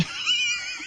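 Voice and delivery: high-pitched cackling laugh; prankster's cackle. Acted. Prank caller greeting an uncle, cackling laugh.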